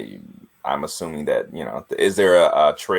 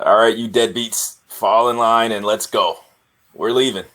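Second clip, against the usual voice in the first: gruff leader rallying the troops, lightly raised; gravelly yelled command; 3 semitones higher than usual. Acted. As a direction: call out orders in a gravelly voice. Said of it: "in a gravelly voice, he'll, uh, yell out".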